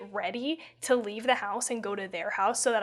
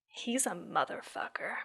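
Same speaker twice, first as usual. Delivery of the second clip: whisper; 4 semitones lower, 9 dB quieter than usual. Retold or imitated. Whispered childhood curse retold.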